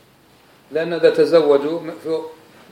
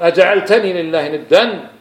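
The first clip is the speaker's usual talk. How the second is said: stern rebuke; 5 semitones higher, 7 dB louder, slower than usual. Retold or imitated. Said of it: "قال".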